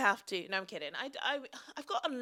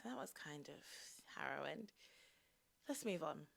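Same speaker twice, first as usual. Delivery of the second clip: breathless whispered relief; 5 semitones lower, 12 dB quieter than usual. Real, not acted. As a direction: hush it out, shaken. Relief after shocking screen time.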